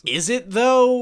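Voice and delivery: high, drawn-out sarcastic question; mock disbelief at the cop; incredulous pushback. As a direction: act baffled, stretch it out slowly. Retold arguing with police.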